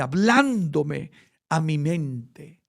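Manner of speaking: normal talking voice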